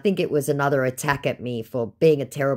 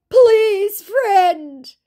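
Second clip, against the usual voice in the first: very high, loud drawn-out begging; hammed-up whining plea; pitiful mock plea; 8 dB louder than usual. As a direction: ham up the pitiful beg. Scammer's final plea.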